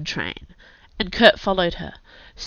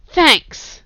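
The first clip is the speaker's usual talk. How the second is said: strained thanks; 11 semitones higher, 7 dB louder than usual. A narrator's reading. Girl calling back, wriggling in a grip.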